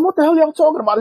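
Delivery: high, loud retold protest; indignant retort. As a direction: act out pushing back indignantly. Acts out his angry retort.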